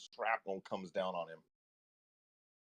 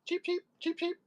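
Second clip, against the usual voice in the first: very high chirps like a pigeon; bird chirping. Pretend. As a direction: squeak out little bird cheeps. Pigeon noise after "meta pigeon.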